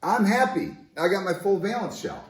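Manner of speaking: cartoon chlorine, contentedly full; happy chlorine. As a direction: play a satisfied little atom. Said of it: "Chlorine says".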